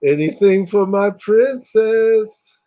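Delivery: slow, high, sleazy imitation; slow high mock sleazy purr; creepy hero line. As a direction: coo it like a creep. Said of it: "he'll be like".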